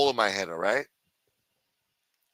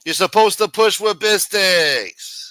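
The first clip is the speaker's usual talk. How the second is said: mocking; 8 semitones higher, 8 dB louder, slower than usual. Mocking the chat's instructions.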